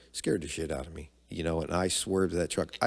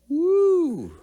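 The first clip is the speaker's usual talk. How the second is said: a sudden high loud whoop; mock whoop; 10 dB louder than usual. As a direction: whoop like the fight just kicked off. Mock cheer as fight erupts.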